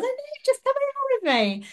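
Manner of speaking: high, quick eager plea; eager insistence. Retold or imitated. Asking a fellow runner to cross the line together, eager. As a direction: urge him on brightly and fast. Retold insisting he finish alongside.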